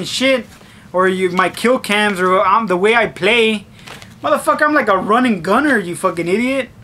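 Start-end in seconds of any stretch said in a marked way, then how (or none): none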